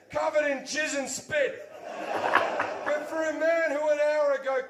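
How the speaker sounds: big excited punchline shout. Real, not acted. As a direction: yell the reveal with rising excitement. Rushing in to find him.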